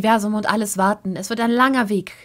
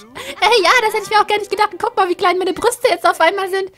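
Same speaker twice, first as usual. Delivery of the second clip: much higher, fast chirpy wife; 10 semitones higher, 8 dB louder than usual. Acted. Wife Sim chatting to husband, high and quick.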